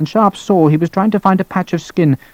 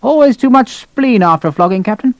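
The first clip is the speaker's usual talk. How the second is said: high-pitched officer's remark; brusque colonel; 8 semitones higher than usual. Acted. Colonel addressing the captain after bleeding, brusque. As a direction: pitch it high, querying at the end.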